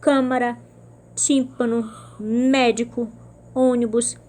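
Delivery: Câmara, tímpano, médico, ônibus, each with a loud first syllable; antepenúltima sílaba forte. Shown as a demonstration. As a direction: punch CÂ, TÍM, MÉ and Ô, word by word. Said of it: "são todas proparoxítonas". (Câmara, tímpano, médico, ônibus, com a primeira sílaba forte)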